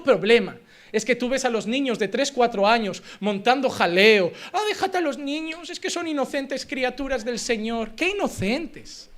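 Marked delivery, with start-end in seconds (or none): 4.5-7.9 mock indulgent pleading. Preacher mimics someone defending rowdy children, mock indulgent pleading.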